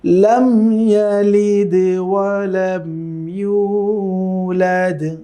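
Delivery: slow melodic tilawat; Quran recitation. Shown as a demonstration. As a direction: chant it slowly, drawn out like in prayer. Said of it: "তো আমরা আবার পড়ছি".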